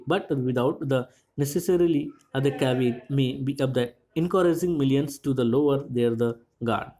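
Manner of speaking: reads the English text word by word, very slowly; unhurried news text read to students; slow reading aloud. Shown as a demonstration. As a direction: dictate it slowly to the class.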